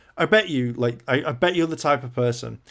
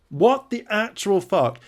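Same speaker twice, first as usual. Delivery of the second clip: high, slow, drawn-out indignant outburst; outraged disbelief; 8 semitones higher, 4 dB louder, slower than usual. Real, not acted. Reacting to Rachel not ruling out cheating, slow and outraged. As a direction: stretch each word in disbelief.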